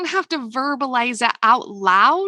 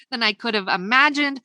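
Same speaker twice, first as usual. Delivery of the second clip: usual speaking voice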